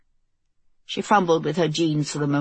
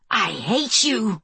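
hissed; 4 semitones higher, slower than usual. Acted. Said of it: "she hissed".

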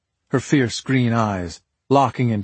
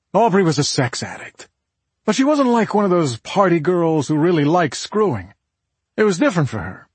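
higher-pitched, insistent husband; emphatic Nick; 7 semitones higher, 3 dB louder than usual. Acted. Nick tells detectives about Aubrey's addiction, emphatic. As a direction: lay it out forcefully, pitch raised.

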